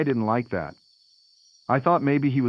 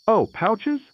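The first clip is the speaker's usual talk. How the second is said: much higher, loud snooty mom; 11 semitones higher, 4 dB louder than usual. Retold or imitated. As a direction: squeal it like a judgy mom. Snooty mom judging pouches.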